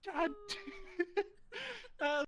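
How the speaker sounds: high, breathless laughter in the words; giggling agreement; laughing. Real, not acted. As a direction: still cracking up, stretching the words out slowly. Still laughing at heckle.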